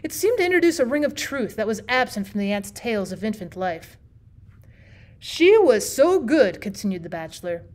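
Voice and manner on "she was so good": very slow, higher and louder; drawn-out emphasis. A narrator's reading. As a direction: stretch out so good. Bachelor emphasizes.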